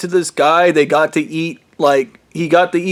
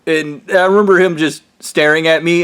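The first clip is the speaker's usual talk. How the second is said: normal talking voice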